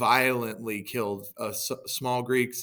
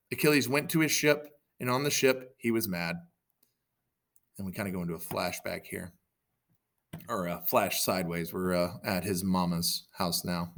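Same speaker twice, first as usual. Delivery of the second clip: plain reading voice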